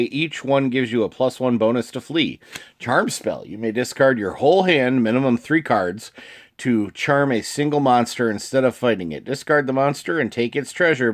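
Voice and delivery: ordinary talk, nothing marked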